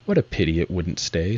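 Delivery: plain reading voice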